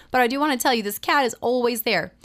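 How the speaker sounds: normal talking voice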